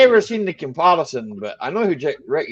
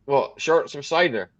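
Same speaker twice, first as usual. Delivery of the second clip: ordinary talk, nothing marked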